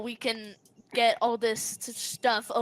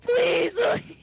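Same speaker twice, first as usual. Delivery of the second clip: desperate pleading; 11 semitones higher, much slower than usual. Acted. Adrian begs for help.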